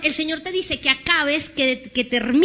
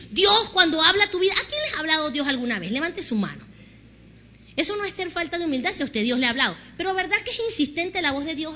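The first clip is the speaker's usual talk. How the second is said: ordinary talk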